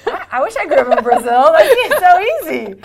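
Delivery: playful laughing envy. Real, not acted. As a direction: joke about being jealous while laughing. Teasing about easy Brazil path.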